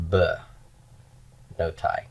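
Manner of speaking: b, no tai with the first vowel cut to a quick schwa; b, no tai, first vowel reduced; reduced first syllable. Shown as a demonstration. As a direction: clip the ba down to a bare b. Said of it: "I will now have".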